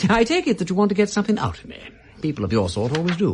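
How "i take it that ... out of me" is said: laughing. Acted. Wimsey mocking Milligan.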